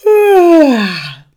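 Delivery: big high yawn, sliding down; loud yawn. A narrator's reading. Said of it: "Emma gähnte laut". (lautes, herzhaftes Gähnen)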